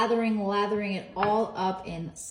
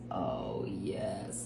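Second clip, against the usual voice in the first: low, whispery relish; soft, very low reverent murmur; hushed awe; 14 semitones lower, 8 dB quieter than usual. Real, not acted. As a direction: breathe it out in hushed delight. Admiring the cooked bacon.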